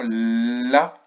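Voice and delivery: LA with a long held L; syllabe reprise. Shown as a demonstration. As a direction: stretch the L, then open into la. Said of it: "Donc on reprend". (« la » avec un L longuement tenu)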